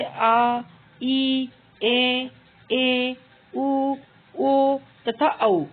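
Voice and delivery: very slow, stretched ओ and औ; दीर्घ स्वर खींचकर बोलना; drawn-out vowels. Shown as a demonstration. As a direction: stretch the long vowels ओ and औ out for the class. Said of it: "अधिक समय लगता है".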